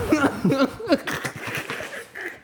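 high laughing fit. Real, not acted. Laughing at the clip.

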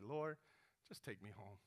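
low, hushed plea to God; weary prayer. Retold or imitated. Elijah asks the Lord to take him home, weary and low. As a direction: let it sink down soft and defeated. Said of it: "Elijah's next prayer was like".

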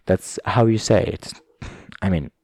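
ordinary talk

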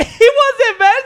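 high, loud, drawn-out laughing protest; laughing disbelief. Real, not acted. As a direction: laugh incredulously through the words. Incredulous laugh at a denial.

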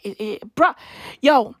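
normal talking voice

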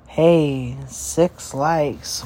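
ordinary talk

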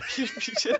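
cracking up mid-song, pitch jumping high; laughing. Real, not acted. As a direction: laugh through the line. Breaking into laughter.

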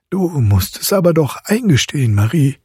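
high, insistent character voice; interrupting godfather. A narrator's reading. Godfather cuts off the mother, high and insistent. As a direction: cut in insistently, pitched high. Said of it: "unterbrach der Obergerichtsrat die Medizinalrätin".

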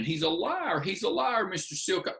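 high-pitched indignant protest; re-enacted outrage without full volume; mock screaming accusation. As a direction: climb in pitch as you accuse. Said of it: "And I am screaming".

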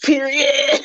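sassy echo. Pretend. Echoing her with flair.

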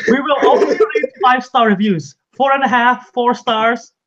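laughing through the words. Real, not acted. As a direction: giggle while saying it. Joking only five-star reviews.

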